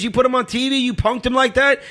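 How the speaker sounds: high-pitched, indignant imitation of the guy; accusing stranger. Stranger challenges him outside church, imitated high indignant voice. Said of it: "He goes".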